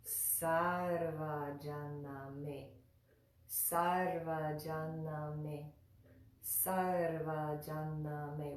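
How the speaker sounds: "sarvajaname" very slowly, plain s and long first syllable; clear s word demo. Shown as a demonstration. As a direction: stretch out "sarvajaname" for learners to copy. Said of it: "that word is".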